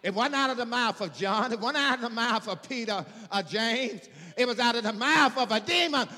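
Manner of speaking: high, loud sermon building to a shout; forceful pulpit delivery, rising in volume; preaching at full force. Real, not acted. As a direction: hammer the repeated phrases to a climax.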